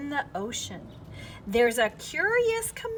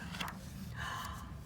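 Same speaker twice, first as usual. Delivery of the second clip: surprised gasp; 14 dB quieter than usual. Acted. Discovering a hidden creature.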